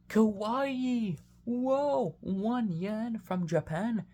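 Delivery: high squealing excited fan; excited anime fan. Pretend. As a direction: gush like a thrilled anime fan. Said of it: "Come on, sing your favorite".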